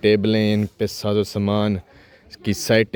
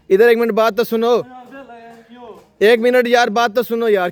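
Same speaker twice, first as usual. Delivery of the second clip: higher, louder call after someone leaving; 13 semitones higher, 5 dB louder than usual. Real, not acted. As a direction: call him back insistently, voice raised high. Calling back someone who walks off. (ऊँची आवाज़ में पुकारते हुए)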